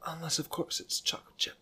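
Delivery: whispered confession. Retold or imitated. Quoting [name] to a co-host, whispering the ice cream exception. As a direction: breathe it out like a secret. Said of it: "which is me".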